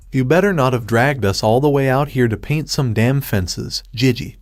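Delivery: ordinary delivery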